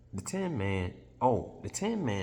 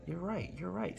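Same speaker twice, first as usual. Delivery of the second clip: Ozma agreeing; 11 semitones higher, 6 dB quieter than usual. Retold or imitated.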